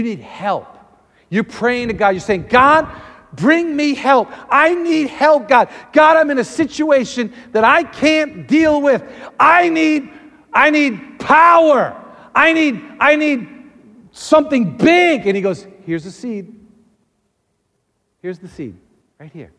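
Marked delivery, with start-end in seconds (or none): 2.5-15.3 high, loud pleading to God; acted cry for help; desperate prayer. 15.9-19.5 soft, matter-of-fact offering; God's calm small answer; understated reply. Acted.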